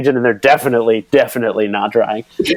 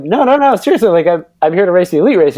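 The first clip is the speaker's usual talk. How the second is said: much higher, fast, mock-desperate pleading; insistent protest; 7 semitones higher, 3 dB louder, faster than usual. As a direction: convince the guard you're really racing. Pleading with the parking guy.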